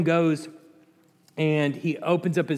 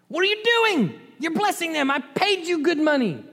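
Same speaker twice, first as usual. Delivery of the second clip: much higher, indignant king's voice; high, exasperated royal complaint; outraged king; 11 semitones higher than usual. Acted. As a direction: scold the hired prophet like an outraged king. The king of Moab berates Balaam.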